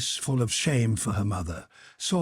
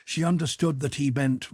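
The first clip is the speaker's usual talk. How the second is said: ordinary delivery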